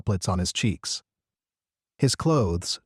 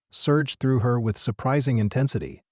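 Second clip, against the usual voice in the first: plain reading voice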